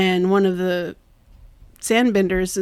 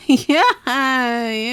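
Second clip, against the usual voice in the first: high, smiling mock reluctance; awkward agreement; 4 semitones higher than usual. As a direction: imitate an awkward, uneasy yes through a smile. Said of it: "he just smiles awkwardly".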